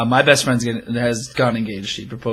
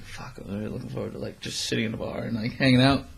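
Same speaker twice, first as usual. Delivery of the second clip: soft, quicker private aside; inner thought; 8 dB quieter, faster than usual. Retold or imitated. Secretly wanted to sit in the bar, quiet inner voice. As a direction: let it drop to a quiet thought to yourself. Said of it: "In the back of my head".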